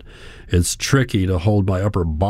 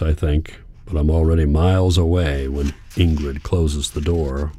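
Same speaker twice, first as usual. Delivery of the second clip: usual speaking voice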